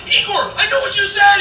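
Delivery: high, loud eager call; acted giddy shout; elated call. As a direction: yell it proudly to your friend. Calling to Igor, thrilled to understand.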